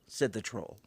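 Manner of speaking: usual speaking voice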